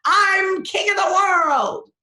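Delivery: hammed-up victory yell; triumphant. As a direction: crow over a beaten rival. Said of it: "like, triumphant".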